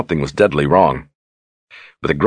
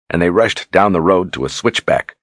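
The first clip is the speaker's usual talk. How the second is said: usual speaking voice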